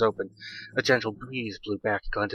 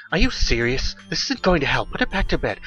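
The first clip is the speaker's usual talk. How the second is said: higher-pitched, mildly exasperated objection; complaining husband; 5 semitones higher than usual. A narrator's reading. Husband objecting to wife carrying daughter out, complaining. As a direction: complain in disbelief, pitch climbing. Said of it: "started complaining".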